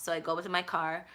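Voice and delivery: usual speaking voice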